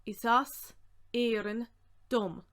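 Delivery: projected, piece by piece; deliberate, separated and firm. Shown as a demonstration. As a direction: pause between the words for learners. Word-by-word teaching breakdown.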